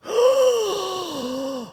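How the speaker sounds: long high gasp of horror; shocked gasp. Retold or imitated. Seeing his hair in the glass, long gasp. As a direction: gasp at the mirror.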